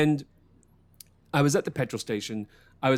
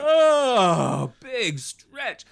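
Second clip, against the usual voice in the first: mock show-off; 9 semitones higher, 5 dB louder, much slower than usual. Mocking show stretch.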